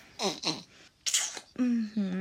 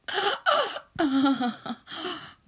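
breathy laugh; 7 semitones higher, 6 dB louder than usual. Acted. Laugh after climax.

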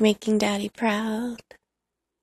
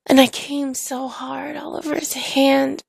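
plain reading voice

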